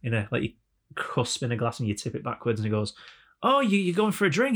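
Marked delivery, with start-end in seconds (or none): 3.4-4.6 high-pitched imitation of dawning understanding; Canadian driver. Canadian finally understands Frank, higher-pitched impersonation. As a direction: voice a friendly stranger catching on. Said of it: "his impersonation of a Canadian".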